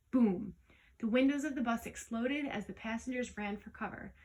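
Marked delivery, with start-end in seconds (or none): 0.1-0.5 loud boom sound. A narrator's reading. Explosion sound word.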